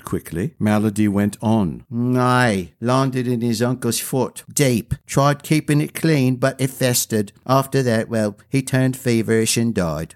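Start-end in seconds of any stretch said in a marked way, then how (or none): 1.9-10.1 plain-spoken village woman; lightly voiced commoner woman. Melody tells the story.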